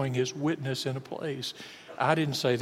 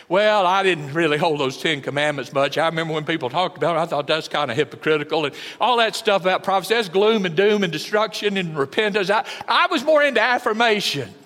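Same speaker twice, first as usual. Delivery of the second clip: lightly voiced self-justifying excuse, louder; mock excuse; 3 semitones higher, 7 dB louder than usual. Preacher mocking a listener who skipped the commandments, whiny. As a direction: whine out your excuses. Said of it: "You did too".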